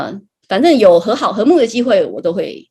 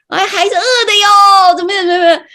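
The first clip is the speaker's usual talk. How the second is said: mock complaint; 13 semitones higher, 7 dB louder than usual. Church member grumbling that her child went hungry, whiny mock complaint. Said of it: "反正意思就是抱怨说".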